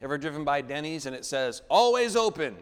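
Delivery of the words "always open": higher, louder, like a cheery slogan; sign read out. Acted. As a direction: sell it like a diner sign. Quoting the Denny's sign.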